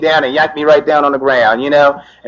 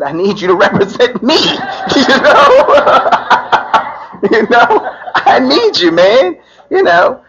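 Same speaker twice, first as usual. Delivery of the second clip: laughing; 10 semitones higher, slower than usual. Real, not acted. Asking buddy to represent him.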